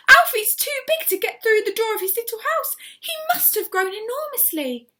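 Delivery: high, loud and breathless; excited shouting. A narrator's reading. Missus Silver alarmed at her grown tortoise, shouting. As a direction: blurt it out in a rush of excitement. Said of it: "Missus Silver was shouting".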